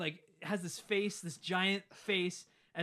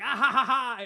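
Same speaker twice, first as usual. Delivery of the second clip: booming mock evil laugh, much higher and louder; villain laugh; 7 semitones higher, 12 dB louder than usual. The monster laughing at the kids, mock evil laugh. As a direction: cackle like a cartoon villain.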